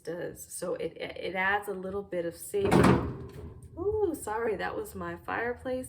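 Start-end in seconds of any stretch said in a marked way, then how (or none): 3.8-4.6 sudden high ooh, real fright; startled. Real, not acted. Reviewer startled by her fireplace mid-review, real startled yelp. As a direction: jump at a loud noise and yelp an apology. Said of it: "Kinda scared me".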